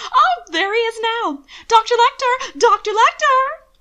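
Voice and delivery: high, loud, shrill hailing; enthusiastic calling out. Acted. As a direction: wave and call across the room. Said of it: "so enthusiastically gesticulating".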